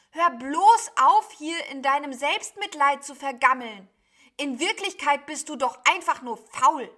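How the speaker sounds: loud, high, heated scolding; exasperated tirade. A narrator's reading. Sheep berates self-pitying cloud beast for laziness, exasperated. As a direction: berate them, pushing pitch and volume up.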